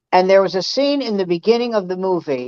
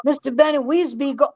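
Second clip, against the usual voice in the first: higher, mock caricature of broken English; 6 semitones higher than usual. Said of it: "he never talked like that".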